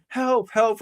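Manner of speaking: high-pitched pretend cry for help; faked helplessness. Roy on the bathroom floor, pretending to need help. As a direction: imitate someone feebly faking distress. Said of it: "And he's going".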